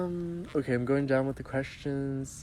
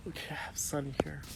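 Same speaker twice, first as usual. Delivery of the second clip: whispered aside; 2 semitones higher, 8 dB quieter than usual. Real, not acted. Aside while reading viewer questions.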